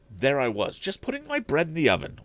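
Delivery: a worried housekeeper pleading with the inventor; housekeeper's voice. A narrator's reading. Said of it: "went on Missus Baggert".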